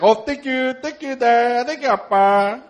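put-on high, sweet little-boy thanks; happy toddler. Dad imitating son thanking for a toy, high and sweet. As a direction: gush thanks like a delighted small child. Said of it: "he's like".

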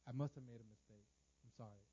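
low, soft contrition, fading; retold humble climb-down; subdued apology. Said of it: "I said".

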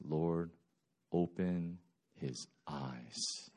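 soft, slow, unhurried prayer; calm prayer. Retold or imitated. Elisha asking God for his servant, soft slow prayer. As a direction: pray it quietly and slowly. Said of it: "He's still so cool".

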